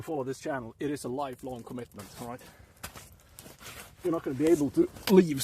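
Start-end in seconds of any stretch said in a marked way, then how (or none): 4.0-5.4 sudden high loud mock warning; mock threat. Streamer warning new followers, high loud mock threat. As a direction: shout it like a playful threat.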